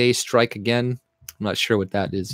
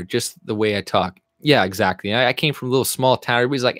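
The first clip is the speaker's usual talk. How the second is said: usual speaking voice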